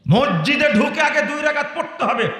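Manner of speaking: angry insistence. Real, not acted. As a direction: lay down the rule in a high, heated voice. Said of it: "রাগ বেশি হয়ে গেছে". (রাগে চড়া গলায় নির্দেশ)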